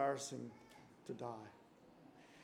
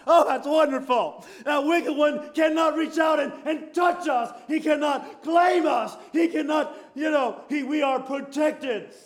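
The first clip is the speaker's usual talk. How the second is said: loud, higher fervent preaching shout; full-force preaching; 8 semitones higher, 5 dB louder than usual. Acted. Preacher declaring to the congregation, loud fervent shout. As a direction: preach it out with rising fire.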